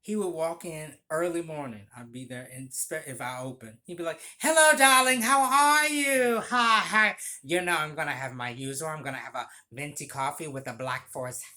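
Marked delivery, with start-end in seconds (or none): 4.4-11.4 theatrical impression of an effusive regular; flamboyant customer. Regular customer greeting his favorite barista, flamboyantly. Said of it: "he would say it like that every time".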